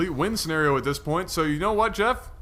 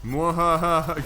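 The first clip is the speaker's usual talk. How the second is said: sneering panto villain; 2 semitones lower, much slower than usual. Pretend. Gloating over opponent's loss.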